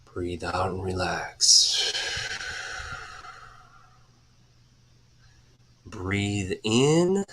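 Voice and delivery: slow, soft breathing-coach voice with airy exhales; guided breathing. Acted. Coach leading viewers through deep breaths, slow and soft. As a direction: ease the listeners through each breath, unhurried. Leads second breathing round.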